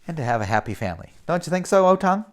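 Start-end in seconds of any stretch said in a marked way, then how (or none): none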